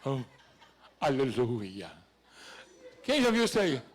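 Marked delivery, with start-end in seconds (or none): 0.0-1.9 mock-solemn, much lower hallelujah; pious altar voice. Preacher mocking how church folk say hallelujah, pious altar voice. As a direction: intone it deep, in put-on reverence. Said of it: "Até a voz muda a entonação".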